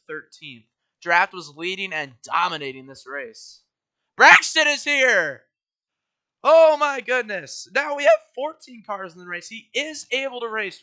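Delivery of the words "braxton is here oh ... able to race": excited. Real, not acted. Surprise driver joins race.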